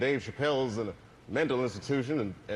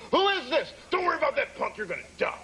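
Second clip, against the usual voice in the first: high, panicky imitation of the scared teacher; mock panic; 12 semitones higher than usual. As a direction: squeal it like a frightened man on the phone. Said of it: "He's, like, freaking out".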